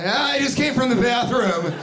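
high, chirpy mock excuse; awkward explainer. Wet-handed greeter explaining himself, high mock-awkward blurt. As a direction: blurt it high, imitating an over-eager greeter.

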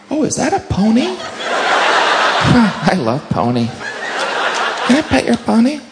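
put-on high, childlike delight; charmed robber. Robber distracted by a cop's pony, high smitten voice. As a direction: coo it in a high, softened voice.

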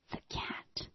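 whispered dread; a barely audible horrified whisper. Retold or imitated. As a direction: breathe it out, horrified. Realizing the bear found the cat.

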